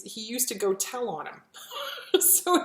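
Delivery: laughing through the memory. Real, not acted. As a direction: breaks into a real laugh. Fond funny dog memory.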